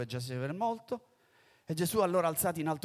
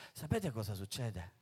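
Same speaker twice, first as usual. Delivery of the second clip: normal talking voice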